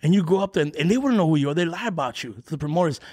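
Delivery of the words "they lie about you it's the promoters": ordinary talk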